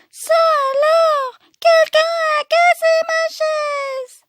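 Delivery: put-on very high, squeaky Baby Bear; tiny crying-out voice. Baby Bear finding his chair broken, tiny high cry. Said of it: "de sa toute petite voix".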